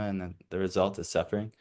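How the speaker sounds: normal talking voice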